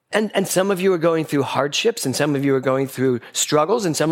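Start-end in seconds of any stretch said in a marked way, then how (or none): none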